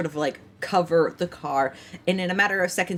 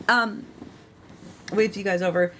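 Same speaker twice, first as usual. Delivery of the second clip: ordinary talk, nothing marked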